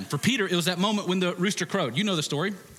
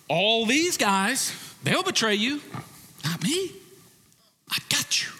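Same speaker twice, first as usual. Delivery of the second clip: cocky Peter; 8 semitones higher than usual. Retold or imitated. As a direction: play the overconfident disciple, a touch hammed. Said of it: "Peter stands up and says".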